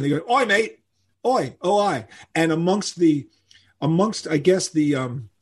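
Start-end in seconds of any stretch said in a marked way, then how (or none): 0.3-2.1 high Cockney oi, mate to grab attention; Cockney call. Retold or imitated. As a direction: hail a mate, Cockney style, pitched up. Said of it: "that's how Cockneys get your attention".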